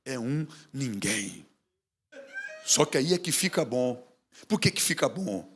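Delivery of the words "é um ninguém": slow, scornful quote of the put-down; slow contemptuous taunt; mocking put-down. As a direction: drag it out like a sneer. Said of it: "as pessoas diziam assim".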